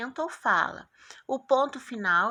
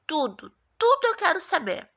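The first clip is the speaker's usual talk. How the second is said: higher, louder, eager to know everything; curious question mark; 7 semitones higher, 4 dB louder than usual. Acted. Teacher voicing the question mark to pupils, curious and insistent. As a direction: play a question mark hungry for answers.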